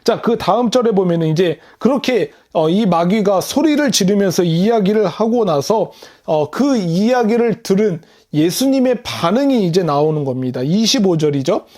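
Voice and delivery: normal talking voice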